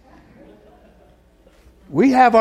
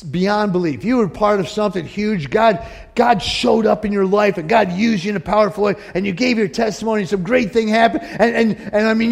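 normal talking voice

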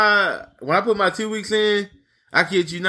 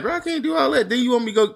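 high-pitched exasperated retort; exasperated quote; 6 semitones higher, faster than usual. Retold or imitated. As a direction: toss it off high and fed up. Retelling complaint to boss.

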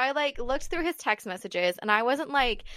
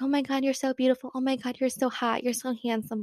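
mock gushing; 4 semitones higher than usual. Mocking retold gushing flirty messages.

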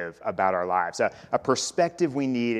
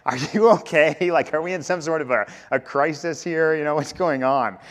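lightly voiced wife, higher pitched; checking on an oddly behaving husband; imitated concerned wife; 5 semitones higher, 5 dB louder than usual. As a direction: raise the pitch in mock alarm. Acts out wife's worried questioning.

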